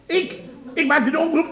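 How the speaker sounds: high outraged ik; indignant surprise. Acted. Boss denying he ruined the announcer, high indignant surprise. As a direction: squeak out the denial.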